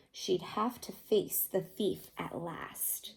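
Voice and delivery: put-on a slow suspenseful whisper to the children; hushed whisper. A narrator's reading.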